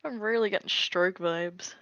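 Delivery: normal talking voice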